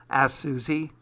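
ordinary delivery

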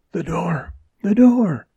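ordinary delivery, nothing marked

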